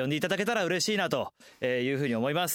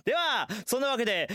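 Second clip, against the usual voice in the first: ordinary delivery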